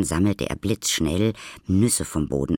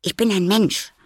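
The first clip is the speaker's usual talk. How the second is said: high, loud, insistent; hasty assurance; 8 dB louder than usual. A narrator's reading. Maya hastening to correct the boy, insistent assurance. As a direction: insist on it urgently, pitched up. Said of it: "beeilte Maya sich zu sagen".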